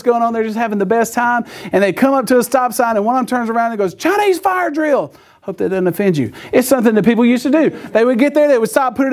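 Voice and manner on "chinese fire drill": high, drawn-out announcement; mock-teen holler without extra volume; excited call-out. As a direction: turn round and call it to your friends, slow and high. Acts out teen calling to friends.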